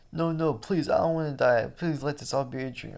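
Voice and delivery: high, desperate begging; pleading victim. Acted. Victim begging the gunman, high and desperate. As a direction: beg not to die.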